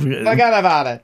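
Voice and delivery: put-on laughing stab at a Jersey accent; mock Jersey accent. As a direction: echo it in a playful New Jersey accent.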